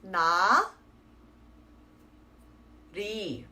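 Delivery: 나 리 as open na, long silence, then ri; two separate syllables, L only starting 리; deliberate pause. Shown as a demonstration. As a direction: stretch 나, wait, then 리. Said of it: "that deliberate pause I'm doing".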